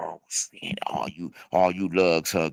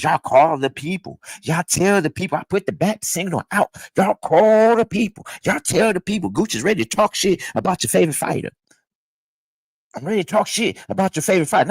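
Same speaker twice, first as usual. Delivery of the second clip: high, fired-up hype call to the fans; rally the people with rising excitement; excited hype; 9 semitones higher than usual. Real, not acted. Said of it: "If y'all hear the excitement in Gooch voice".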